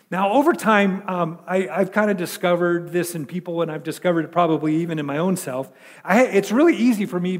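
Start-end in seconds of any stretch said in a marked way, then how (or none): none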